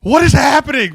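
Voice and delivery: loud, high, baffled shout; a shocked high yell of confusion; stunned disbelief. Retold or imitated. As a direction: blurt it out, completely thrown. Player stunned by coach's switch.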